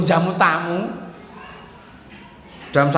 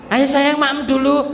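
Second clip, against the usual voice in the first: put-on higher, slow coaxing voice to a child; coaxing mother; 8 semitones higher, 3 dB louder than usual. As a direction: coax a child to eat.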